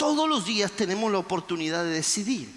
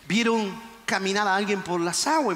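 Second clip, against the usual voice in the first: usual speaking voice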